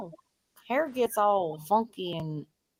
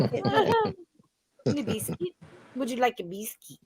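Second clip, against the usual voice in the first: put-on British accent; 3 semitones higher, slower than usual. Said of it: "the Australian accent or the British".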